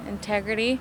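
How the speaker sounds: normal talking voice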